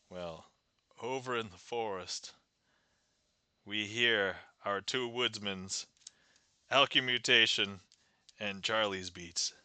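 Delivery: ordinary delivery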